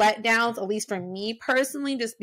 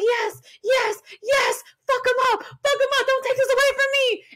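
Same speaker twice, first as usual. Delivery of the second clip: excited cheering; 13 semitones higher than usual. Retold or imitated. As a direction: cheer them on like a fan at ringside. Cheering as the allies arrive.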